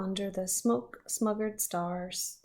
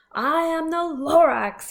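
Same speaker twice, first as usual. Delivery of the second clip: loud, higher Lorax announcing himself; 8 semitones higher, 11 dB louder than usual. Acted. Reader voicing the Lorax to the Onceler, loud and high. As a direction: boom out the Lorax's name. Lorax voiced in read-aloud.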